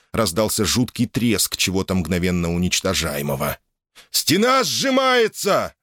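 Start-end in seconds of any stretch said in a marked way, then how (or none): 4.1-5.7 high, drawn-out shout of alarm; hoarse croaking cry. A narrator's reading. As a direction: cry out a warning, slow and very high. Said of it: "хрипло крикнул, словно каркнул Самвел".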